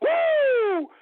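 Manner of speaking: big laugh into a high, loud "woo"; laugh and whoop. Acted. Host returning the caller's Friday cheer, whooping. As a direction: cap the laugh with a celebratory whoop.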